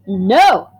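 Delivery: a sudden loud shouted no; a boy's angry shouted refusal. Acted. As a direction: yell it, slamming the table. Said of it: "I shout".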